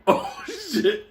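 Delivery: high-pitched "oh shit" of dread; mock alarm. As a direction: imitate onlookers taking fright. Cellmates react as man advances.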